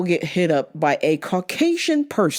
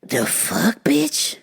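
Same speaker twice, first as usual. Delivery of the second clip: pitched way up in stunned fury; outraged disbelief; slower than usual. Real, not acted. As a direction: let the outrage crack upward, high. Outrage at racist customer.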